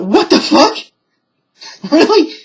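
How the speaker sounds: high, loud imitation of stunned mother; mock disbelief. Retelling mother told she's under arrest, mock disbelief.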